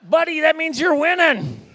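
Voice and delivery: high, loud, stretched-out reply; mock cheering shout. Dance game encouraging a reluctant player, mock shout. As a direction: holler it slowly like a hammed-up game coach.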